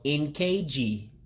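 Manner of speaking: "N K G" slowly, as N, kay, jee; slow pronunciation demo. Shown as a demonstration. Teacher showing the Igbo word for second, sounded out slowly. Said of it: "which makes a K sound".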